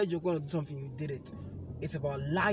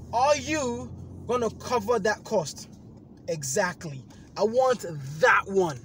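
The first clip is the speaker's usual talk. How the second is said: louder, higher slow insistence; angry demand; 7 semitones higher, 7 dB louder than usual. Retold or imitated. Demanding the delivered item from the agent, angry. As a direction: dig in and demand.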